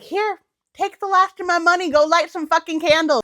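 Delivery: high, loud desperate plea; mock desperate self. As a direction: hand over your last cash in a mock panic. Quoting herself to Etsy witch.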